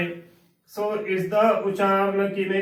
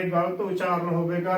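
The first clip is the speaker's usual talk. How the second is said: ordinary delivery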